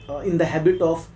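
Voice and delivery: normal talking voice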